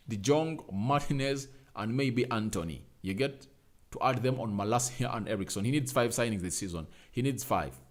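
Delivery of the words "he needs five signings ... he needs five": ordinary talk, nothing marked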